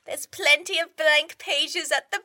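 mother nagging her son about grandchildren; nagging mum. Acted. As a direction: play the pushy mother hinting.

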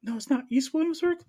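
high, loud indignant squeal; mock indignant protest. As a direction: whine it like a wounded local. Mocking his own protest.

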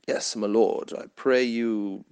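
a hint of a theatrical medieval courtier begging his lord; mock old English court speech. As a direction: plead like a knight in a Shakespeare play. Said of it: "talking like that".

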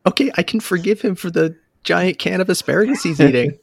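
high, loud, giddy inner voice; thrilled gushing, pitch leaping way up; giddy delight. Retold or imitated. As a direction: gush over finding a fellow fan. Delighted that the coworker knows the game.

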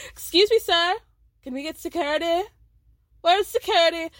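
mock call for help. [name] calling the waiter for security, loud. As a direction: hail the waiter in mock alarm.

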